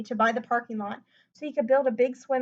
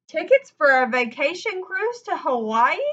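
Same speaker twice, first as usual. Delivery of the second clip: loud, higher astonished question; gasped; 5 semitones higher, 8 dB louder than usual. A narrator's reading. Parents opening the present, gasping in surprise. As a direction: gasp it in disbelief. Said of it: "they gasped".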